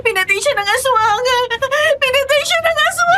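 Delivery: high wailing through sobs, building; crying. Acted. Melay cries to sir about her slain father, hysterical wailing. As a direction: sob it out, climbing to a shrill wail. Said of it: "Bakit ka umiiyak?".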